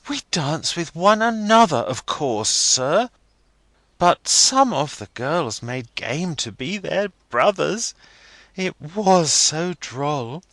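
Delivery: put-on young girl Rosa. A narrator's reading. Rosa voiced in story.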